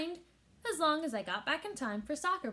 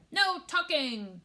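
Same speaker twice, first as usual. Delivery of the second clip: slow, loud, higher chant; crew yelling; 6 semitones higher, 6 dB louder, slower than usual. A narrator's reading. Crew backs the captain, slow loud yell. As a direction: yell it together slowly. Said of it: "the crew yelled".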